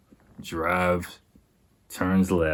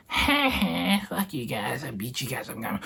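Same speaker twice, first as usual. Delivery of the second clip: mock taunting drunk; 7 semitones higher, 5 dB quieter than usual. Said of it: "yelling at them".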